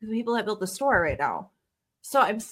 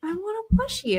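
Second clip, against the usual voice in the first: put-on child voice; 10 semitones higher than usual. Son voiced in story.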